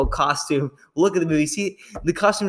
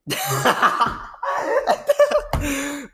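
laugh; 11 semitones higher than usual. Real, not acted. Laughing after creepy drink remark.

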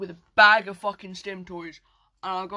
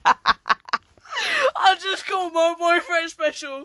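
slow, high, through tears of laughter; slow, higher, laughing; 8 semitones higher, 4 dB louder, much slower than usual. Real, not acted. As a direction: squeeze it out between giggles. Laughing at own joke.

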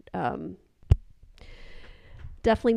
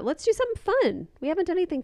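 excited; 10 semitones higher, much faster than usual. Retold or imitated. Proposing a fun episode idea.

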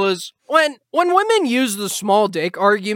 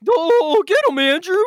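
much higher, squealing fanboy cheer; 9 semitones higher, faster than usual. Retold or imitated. Host mocks fans cheering the influencer, high squealing cheer. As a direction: egg him on like a giddy fanboy. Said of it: "They're clapping like seals".

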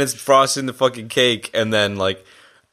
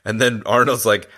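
ordinary delivery

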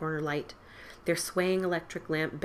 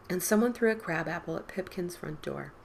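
plain reading voice

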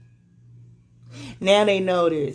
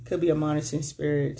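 ordinary delivery

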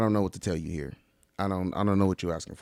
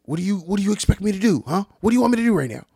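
raised, high, exasperated demand; mock argument; 9 semitones higher, 5 dB louder, much faster than usual. Overheard traveler arguing with companion, high and exasperated. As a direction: fire it off like a fed-up partner. Acted quarrel of unhappy travelers.

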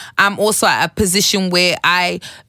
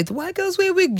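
much higher-pitched mock quote; mimicked gossip; 12 semitones higher, 4 dB quieter than usual. Wig stylist quoting what people say, high-pitched mimicry. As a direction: imitate others' remark in a raised, high voice.

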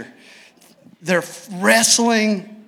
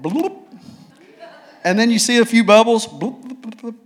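bubble sounds; 5 semitones higher, 4 dB louder than usual. Retold or imitated. Crocodile dive bubbles.

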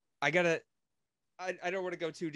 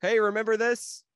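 loud, high mocking callback; mock the movie's nostalgia bait; sarcastic; 8 semitones higher, 8 dB louder than usual. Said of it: "it was pretty much like a".

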